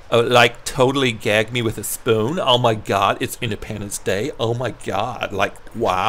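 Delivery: low, soft mock Californian Valley girl; Californian accent. Shown as a demonstration. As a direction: put on a drowsy, low-pitched Californian accent. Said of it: "Let me try a Californian accent".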